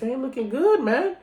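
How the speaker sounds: high-pitched alarmed viewer; mock worried. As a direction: fret in a high voice. Voicing his own worried reaction.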